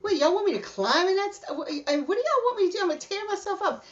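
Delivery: high-pitched, balking objection; retold protest. Balking at climbing through barbed wire, high retold protest.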